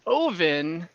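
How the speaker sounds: normal talking voice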